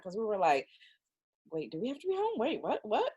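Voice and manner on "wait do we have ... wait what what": high, soft retold fluster; mildly panicked double-checking; nervous questioning. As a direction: imitate a nervous, higher-pitched reaction. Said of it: "out of nervousness".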